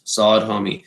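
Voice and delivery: normal talking voice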